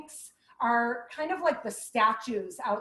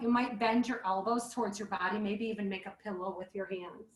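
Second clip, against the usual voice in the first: ordinary delivery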